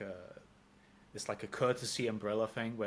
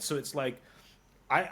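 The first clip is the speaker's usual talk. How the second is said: ordinary talk, nothing marked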